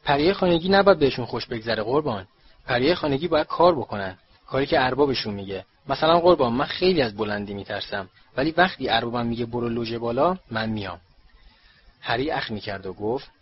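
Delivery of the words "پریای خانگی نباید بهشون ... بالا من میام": usual speaking voice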